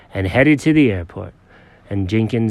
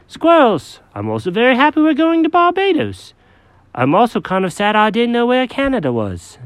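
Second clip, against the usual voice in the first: high, fussy cat voice; Jenkins the cat; 11 semitones higher than usual. Acted.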